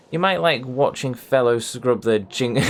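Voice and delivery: words cracking up mid-line; giggling through "You might like watching fellows scrub their jing-"; breaking into laughter. Real, not acted. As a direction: lose it halfway through the line.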